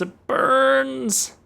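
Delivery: high, loud mock cry of pain; hammed-up suffering; mock anguish. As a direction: wail "It burns" like a wounded hero. Mock pain over grade.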